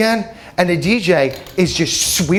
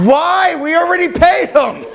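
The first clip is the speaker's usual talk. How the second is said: high, louder protest; indignant protest; 12 semitones higher, 4 dB louder than usual. Retold or imitated. Protesting to the wife about paying the band, high and loud. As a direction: push back in disbelief.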